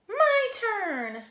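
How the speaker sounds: high, loud toy-snatching yell; loud, high-pitched demand; yelled grab. Acted. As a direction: shout it like a grabby little sister. Sister grabs toy in story.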